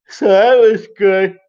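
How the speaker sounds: slow words stretched by a real yawn. Real, not acted. Streamer to viewers about sleeping early, yawning. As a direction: let the yawn pull the words out slowly. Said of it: "I don't know why I'm yawning".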